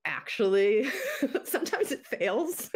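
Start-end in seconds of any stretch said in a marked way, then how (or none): 1.2-2.7 high-pitched, laughing through the words; laughing admission. Real, not acted. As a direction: admit it with a real giggle. Laughs admitting her experiments fail.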